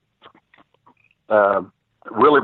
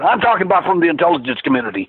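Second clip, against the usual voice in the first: retold angry rant, much higher and fast; furious; 7 semitones higher, faster than usual. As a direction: rattle it off like the fuming webmaster. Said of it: "He was furious".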